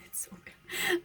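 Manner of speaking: breathy secret whisper, voice dropped right away; excited whisper. Real, not acted. Telling her viewers her new book is great, whispered. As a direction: hush it out like sharing a delicious secret.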